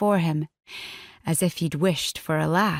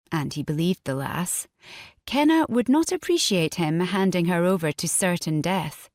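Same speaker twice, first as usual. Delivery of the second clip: usual speaking voice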